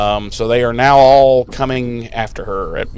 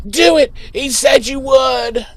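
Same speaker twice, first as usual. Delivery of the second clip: pleading shout; 11 semitones higher, slower than usual. Retold or imitated. Spike shouting at Buffy to kill him. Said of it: "he's like".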